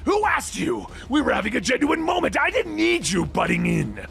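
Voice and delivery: high, furious shout; angry snarl. Acted. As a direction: snarl at the intruder. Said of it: "He snarls".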